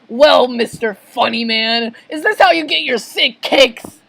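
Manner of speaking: loud, rising indignation; irate accusation; angry customer. Acted. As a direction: confront him, building to a high finish. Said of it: "A fish walks out and angrily".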